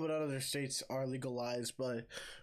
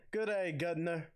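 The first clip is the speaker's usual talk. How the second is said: cheeky put-on British greeting; British accent; 4 semitones higher than usual. Shown as a demonstration. Demonstrating the voice to co-host, mock British accent. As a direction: ham up a British accent a little higher. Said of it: "I do it in a British accent".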